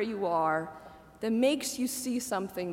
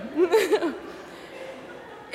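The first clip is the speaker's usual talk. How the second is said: airy laugh; 6 semitones higher than usual. Real, not acted. Laughs at own aside.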